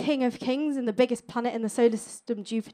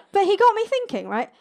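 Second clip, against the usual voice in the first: ordinary talk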